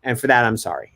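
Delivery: ordinary talk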